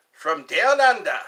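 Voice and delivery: loud put-on Aussie accent; mock Australian accent. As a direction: proudly play up the Australian accent. Playing at being Australian.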